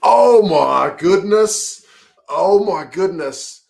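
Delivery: drawn-out, high "oh my goodness", then easing off; slow amazed exclamation, real shock; stunned gasp. Real, not acted. Tense scrum moment, fan reacting.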